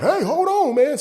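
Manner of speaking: high put-on buddy voice calling out; mocking coworker calling out. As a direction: call after him in a squeaky voice. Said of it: "And he like".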